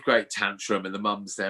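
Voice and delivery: ordinary talk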